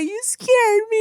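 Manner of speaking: put-on very high little-girl whine; imitated upset child. Small daughter to her dad after his yelling, imitated upset child.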